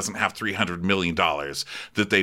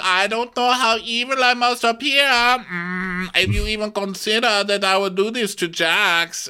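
mock Diana; 12 semitones higher than usual. Podcaster mocking Diana's defense, high and haughty. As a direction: play her as wounded and lofty. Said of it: "And Diana's like".

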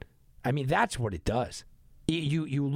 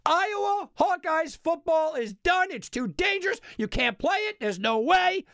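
high, loud, hammed-up doom; mock announcement; 13 semitones higher, 4 dB louder than usual. Host parodying the Iowa cancellation, loud mock-grave announcement. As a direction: ham it up like a grave official verdict.

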